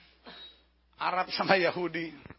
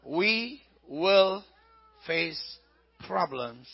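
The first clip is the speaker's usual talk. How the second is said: ordinary delivery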